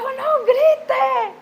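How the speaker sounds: high, loud shout; sudden high-pitched scolding scream; re-enacted yell. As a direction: yell the order at full voice. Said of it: "mi hija entró gritando".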